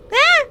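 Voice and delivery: sharp, very high startled shriek; high scream. Acted. Heroine seized in the dark, piercing scream. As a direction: shriek as you are grabbed. Grabbed by captor.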